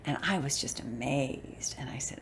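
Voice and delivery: hushed awed whisper. Real, not acted. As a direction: breathe it out in awe. Awed whisper recalling studio.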